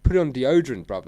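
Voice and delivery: loud high teasing scold; mock telling-off. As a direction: tease him loudly. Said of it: "I'm like".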